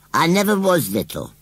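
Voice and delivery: high, loud scarecrow protest; indignant. A narrator's reading. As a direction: declare it, deeply affronted. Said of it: "declared Gummidge indignantly".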